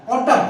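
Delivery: ऑटम ends on a clear m, the n not sounded; silent n. Shown as a demonstration. Teacher demonstrating to students that ऑटम ends on m. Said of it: "ऑटम म बोल रहा हूं मैं".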